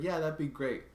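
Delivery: high, chirpy imitation of the clerk; mock clerk. Imitating the clerk's bright, high reply to the annoyed caller. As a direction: chirp it back sweetly like the clerk. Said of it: "And she's like".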